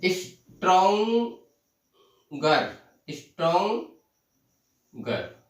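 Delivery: "Stronger" very slow, drawn-out first part, pause before गर; in separate pieces. Shown as a demonstration. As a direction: stretch "stronger" and break off the final गर. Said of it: "टुकड़ों में बोला जाता है". ("Stronger" को खींचकर, टुकड़ों में)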